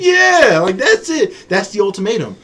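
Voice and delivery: loud, high-pitched emphatic outburst; excited agreement. Real, not acted. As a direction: burst out agreeing at the top of your voice. Excited agreement with co-host's ultimatum.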